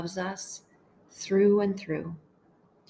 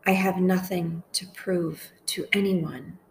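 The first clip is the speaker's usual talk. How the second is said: slow, measured meditation voice with long pauses; unhurried, deliberate phrases for listeners to echo; guided prayer meditation; slower than usual. Acted. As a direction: pray each short phrase slowly, leaving space to repeat it.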